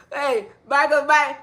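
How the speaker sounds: ordinary talk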